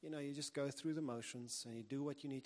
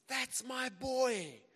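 long, high, full-voiced proud shout; screaming; 12 semitones higher, 9 dB louder, much slower than usual. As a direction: bellow it slowly, like a father cheering. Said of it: "as he's screaming that".

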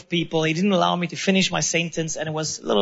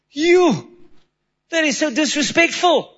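sharp, high indignant cry; retelling losing his cool, high and indignant; retold outburst; 7 semitones higher than usual. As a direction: snap back, offended. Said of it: "So I lost my cool".

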